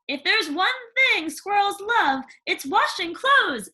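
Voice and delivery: put-on high, bright chatterbox girl; gushing young girl, rising as she goes; eager Cindy telling about animals. Cindy voiced in story.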